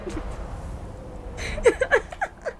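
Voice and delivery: high airless laugh; real wheezing laugh; silent laugh. Real, not acted. Laughing after cursing the TV cook, breathless.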